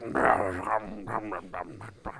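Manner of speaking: low, marble-mouthed wordless mumble; soft garbled noise, as if chewing marbles; Nick Nolte. Acted. Podcaster mimics the actor's voice, low mumble. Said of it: "chewing a bunch of marbles".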